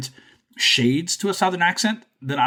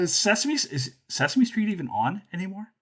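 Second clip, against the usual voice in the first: higher mock-puzzled voice; doubtful public; 6 semitones higher than usual. Podcaster mimicking people asking about Sesame Street, higher and doubtful. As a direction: lightly act out a clueless skeptic. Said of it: "People are like".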